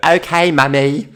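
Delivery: put-on high, loud child's voice with a Portuguese twang; little boy answering. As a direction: imitate a small boy answering his mum. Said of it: "little Luca will look up and go".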